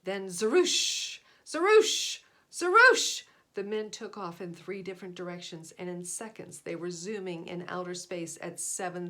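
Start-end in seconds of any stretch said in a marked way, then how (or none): 0.0-3.2 rocket whoosh. A narrator's reading. Spaceships blasting off.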